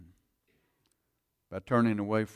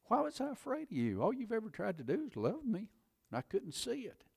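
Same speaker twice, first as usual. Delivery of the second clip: much higher, amazed inner voice; higher, soft wonder at God's love; wondering realization; 10 semitones higher, 5 dB quieter than usual. Retold or imitated. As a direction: marvel at being loved. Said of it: "I thought".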